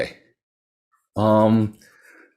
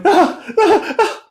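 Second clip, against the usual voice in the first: much higher, louder panting; terrified gasps; 5 dB louder, slower than usual. Acted. Merchant collapses at the soba seller's feet, panicked high gasps. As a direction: gasp in terror.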